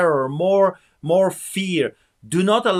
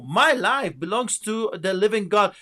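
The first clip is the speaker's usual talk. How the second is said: ordinary talk, nothing marked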